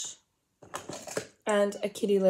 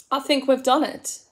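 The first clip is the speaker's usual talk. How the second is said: ordinary talk, nothing marked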